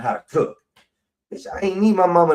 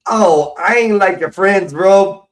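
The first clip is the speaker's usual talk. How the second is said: upset outburst; 6 semitones higher, 7 dB louder, slower than usual. Real, not acted. Scolding someone.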